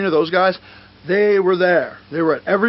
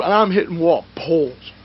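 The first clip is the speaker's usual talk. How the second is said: normal talking voice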